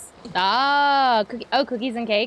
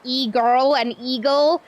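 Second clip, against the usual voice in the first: ordinary delivery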